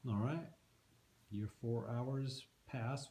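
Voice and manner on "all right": normal talking voice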